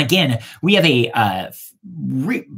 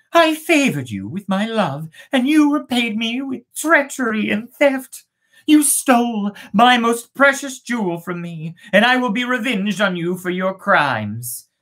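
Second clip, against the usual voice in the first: wronged and vengeful; 8 semitones higher than usual. Acted. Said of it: "cried the witch maiden".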